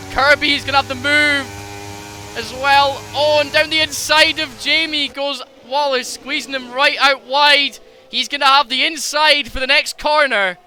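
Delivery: rising excitement on the overtake; excited commentary. Real, not acted. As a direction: call the pass with real excitement.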